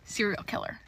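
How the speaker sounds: flat, soft verdict; quiet deadpan judgement; low deadpan. Real, not acted. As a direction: mutter it darkly. Verdict, first take low.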